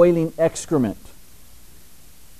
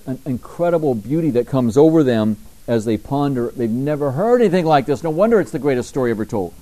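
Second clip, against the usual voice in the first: normal talking voice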